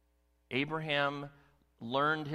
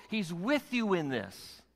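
normal talking voice

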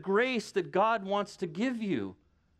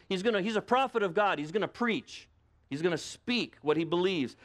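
usual speaking voice

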